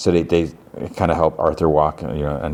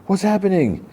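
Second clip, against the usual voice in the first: acted alarm. Boy sees alien burst out, high acted alarm. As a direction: squeak it out as a mock panicked question. Witness reacts to chest bursting.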